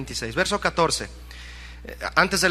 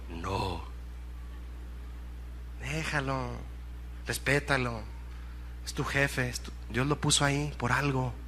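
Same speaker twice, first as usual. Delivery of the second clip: low, soft, restraining advice; hushed counsel; 6 semitones lower, 10 dB quieter than usual. Real, not acted. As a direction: gently talk someone down, very quietly. Gently counselling a disgruntled employee. (consejo en voz baja y suave)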